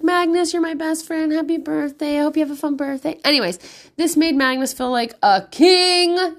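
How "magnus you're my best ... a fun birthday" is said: put-on high-pitched little kid's birthday message; little boy. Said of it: "another little boy sent him".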